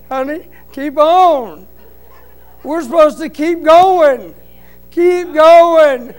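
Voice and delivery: urging his wife on. Retold or imitated. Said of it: "I said".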